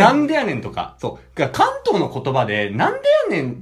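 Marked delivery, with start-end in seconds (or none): 0.0-0.6 louder Kansai-style retort; a punchy Kansai comeback; Kansai tsukkomi. Shown as a demonstration. Kanto man trying out a Kansai retort, in a light Kansai voice. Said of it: "すごい使える言葉".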